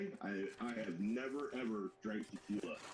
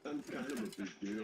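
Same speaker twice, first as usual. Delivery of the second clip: ordinary delivery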